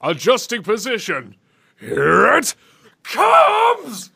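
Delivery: rising to a shouted "comes"; imitating the villain. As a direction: copy the game line. Imitating the clip just played.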